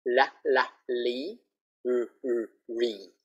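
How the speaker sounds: "La, la, Lee" with the tongue tip, then "R, R, Re" curled; L versus R. Shown as a demonstration. Teacher contrasting L and R mouth shapes. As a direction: show the mouth difference. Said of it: "Can you see my mouth?".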